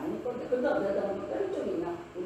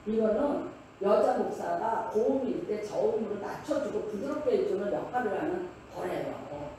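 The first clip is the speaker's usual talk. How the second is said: normal talking voice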